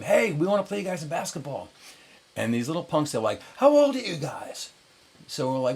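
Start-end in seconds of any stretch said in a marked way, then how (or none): 0.0-1.7 high, mild mock yell; retold yelling. Crew hails kids on a rival boat, mock yelling. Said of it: "we're yelling at them". 3.6-4.7 high, louder imitation of cocky kids; mocking challenge. College kids answer older challengers, mocking.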